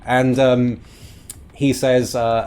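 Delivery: ordinary delivery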